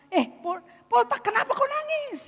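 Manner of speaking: high-pitched acted question; startled concern. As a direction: question a crying child in surprise. Mother notices her crying child.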